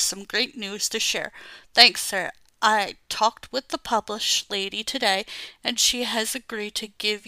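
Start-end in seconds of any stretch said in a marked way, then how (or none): none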